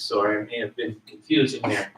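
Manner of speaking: ordinary talk, nothing marked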